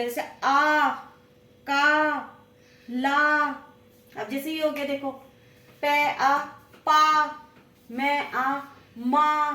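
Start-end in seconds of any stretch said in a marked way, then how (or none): 0.4-3.5 आ, का, ला each hit loud and drawn out; teacher drilling the long आ; stressed vowel sound. Shown as a demonstration. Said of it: "pressure पड़ेगा, जोर पड़ेगा". 5.8-7.2 पे आ blended into पा, said loud; sounding out. Shown as a demonstration. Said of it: "तो आ की आवाज निकलेगी".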